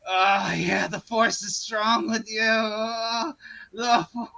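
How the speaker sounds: moaning with mock pleasure; slow, suggestive groaning villain parody, breaking up at the end; the Emperor. Emperor really liking it as Anakin bows, mock-ecstatic moaning. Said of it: "the Emperor's really liking it".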